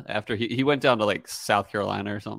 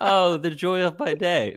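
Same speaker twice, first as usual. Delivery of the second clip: higher, drawn-out doting coo; retold delight; 8 semitones higher, slower than usual. Parent acting out seeing Beck after a bad day, higher-pitched delight. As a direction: melt at the sight of the child. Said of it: "I go see Beck and I'm like".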